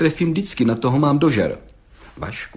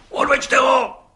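roaring; 13 semitones higher, slower than usual. A narrator's reading. District judge roaring at the gendarme to take him away. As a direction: roar the order across the room. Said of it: "Zařval okresní soudce".

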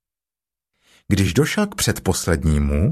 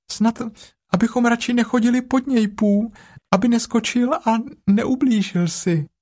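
very high, small piglet voice; high-pitched little animal; 12 semitones higher than usual. Acted. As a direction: squeak it out like a tiny piglet. Piglet worries about the jaguar.

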